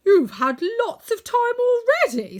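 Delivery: high, loud outburst; angry. A narrator's reading. Tin Woodman objects to the wizard's delay, angry and loud. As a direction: protest angrily at full voice. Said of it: "stated the Tin Woodman angrily".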